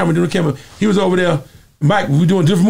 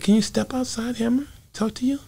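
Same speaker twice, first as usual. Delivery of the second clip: high, much softer, gentle voice; 10 semitones higher, 8 dB quieter than usual. Retold or imitated. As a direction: speak up high and softly like a shy star. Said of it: "he said".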